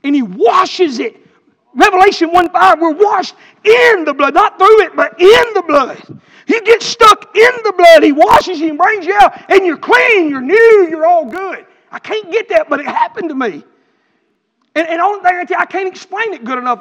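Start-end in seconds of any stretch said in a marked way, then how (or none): 0.0-11.7 loud, high-pitched shouted preaching; full-force preaching. Acted. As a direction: preach it with everything, slow and loud on the key words.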